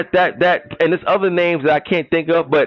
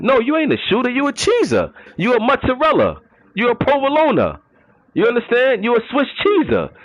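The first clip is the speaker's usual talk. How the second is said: pitched up, teasing put-down; higher mocking taunt; 6 semitones higher than usual. Real, not acted. Roasting cheesy players in his league, higher mocking taunt. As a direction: roast them, voice riding high.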